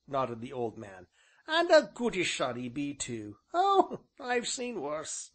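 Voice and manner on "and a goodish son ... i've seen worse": high, louder rustic elder, slowing at the end; old man's grudging praise. A narrator's reading. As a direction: village ancient, drily approving. Voices the ancient.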